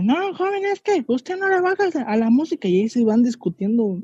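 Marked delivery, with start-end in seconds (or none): 0.0-2.7 high complaining señora voice; mock grumbling passenger; nagging older lady. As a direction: scold the young man like a fussy lady. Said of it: "la señora grillera que dice".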